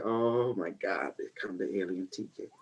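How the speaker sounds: hushed dread. Retold or imitated. Dreading the teacher's arrival.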